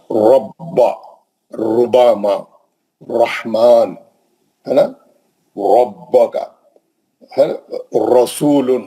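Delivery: heavy ra recitation; heavy ra demo. Shown as a demonstration. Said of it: "را موٹی پڑ جائے گی".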